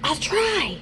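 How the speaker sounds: soft, hushed answer; hushed reply. A narrator's reading. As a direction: keep your voice right down. Hiding, baby must stay quiet.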